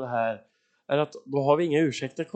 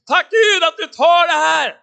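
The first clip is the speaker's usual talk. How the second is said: high, slow, loud praise to God; shouted thanksgiving; 12 semitones higher, 10 dB louder than usual. Acted. Preacher voicing Jesus thanking God, exultant shout.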